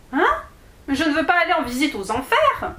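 high, fast alarmed protest; startled child. Acted. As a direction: jump at the idea of hell. Alice startled by the idea. (petite fille surprise et inquiète)

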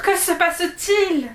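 high, drawn-out frightened cry; sudden fear. A narrator's reading. Alice suddenly finding herself somewhere unknown, frightened. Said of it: "soudain prise par la peur".

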